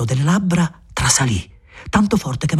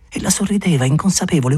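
ordinary delivery

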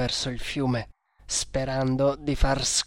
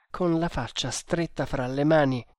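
usual speaking voice

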